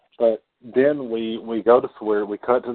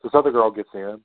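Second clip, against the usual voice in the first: ordinary talk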